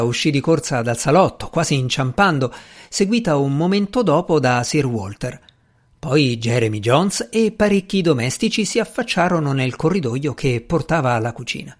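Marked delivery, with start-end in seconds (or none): none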